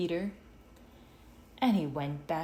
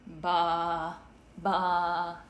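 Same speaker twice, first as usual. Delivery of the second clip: sheep baa; 4 dB louder than usual. A narrator's reading. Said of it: "The sheep said".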